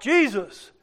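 loud, high-pitched desperate plea; crying out. Acted. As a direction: call out for mercy at full voice. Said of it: "begins to cry out".

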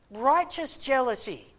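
ordinary delivery

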